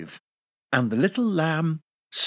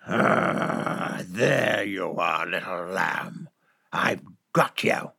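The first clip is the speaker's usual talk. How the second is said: low, hushed, creeping wolf; big bad wolf cornering the lamb; menacing wolf; 5 semitones lower, slower than usual. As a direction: breathe it low like a wolf closing in.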